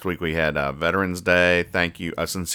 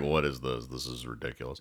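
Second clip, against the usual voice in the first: lightly voiced Garfield, deep and soft; bad Garfield impression; 7 semitones lower, 12 dB quieter than usual. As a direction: mumble it like a lazy Garfield, half-hearted. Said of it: "That was not a good Garfield imitation".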